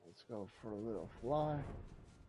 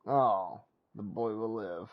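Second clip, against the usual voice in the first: sarcastic echo; 11 dB louder, slower than usual. Pretend. Echoing game line mockingly.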